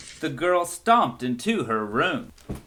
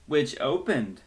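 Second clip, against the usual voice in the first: plain reading voice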